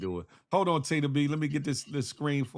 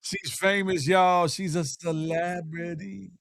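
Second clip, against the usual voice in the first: drawn-out, higher announcer call; hype announcer; 5 semitones higher, much slower than usual. Acted. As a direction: introduce a celebrity guest with stretched words. Hypes up a guest introduction.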